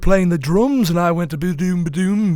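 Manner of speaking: hammed-up Liverpudlian rock star.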